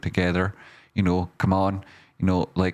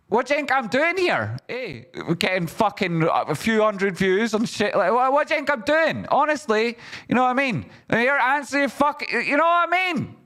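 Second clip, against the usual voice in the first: much higher pitched, swearing outburst; exasperated rant. Real, not acted. Streamer venting at viewers who ignore his help, high exasperated rant. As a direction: let the frustration spill out high. Ranting about ungrateful viewers.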